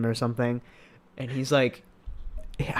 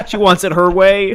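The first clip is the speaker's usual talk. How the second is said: higher, much louder, through laughter; amused punchline; 6 semitones higher, 10 dB louder than usual. Retold or imitated. Retelling a comedian's bit to a friend, laughing and loud. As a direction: crack up while delivering the punchline loudly.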